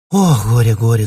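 loud, repeated lament. A narrator's reading. Ksyusha repeats her grief by rote, loud lament. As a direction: wail it out mechanically. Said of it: "приговаривала Ксюша машинально".

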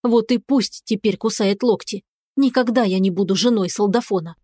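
ordinary delivery

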